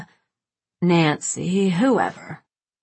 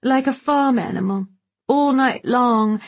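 tipsy gossip; 6 semitones higher, 4 dB louder than usual. Acted. Gloria tipsy, gossiping to Truman.

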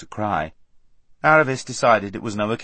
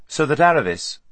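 ordinary delivery, nothing marked

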